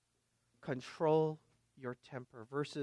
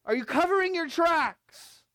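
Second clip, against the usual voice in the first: louder, higher, accusing question; pressing question; 14 semitones higher, 10 dB louder than usual. Acted. Preacher confronting the church, loud and high. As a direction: press the church hard on covering.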